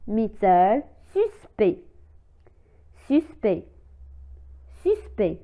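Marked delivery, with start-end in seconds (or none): 1.1-5.4 suspect said as "suspè", no c or t at the end; silent final ct. Shown as a demonstration. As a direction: drop the ct in suspect. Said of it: "لا تنطق لا لتر سي تي".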